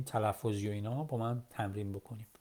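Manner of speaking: ordinary delivery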